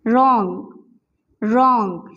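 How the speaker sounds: रॉन्ग as "rong", no w; silent w in wrong. Shown as a demonstration. Teacher repeating wrong without w. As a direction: drop the w in रॉन्ग. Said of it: "डब्ल्यू ... silent है".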